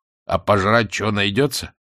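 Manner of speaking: ordinary delivery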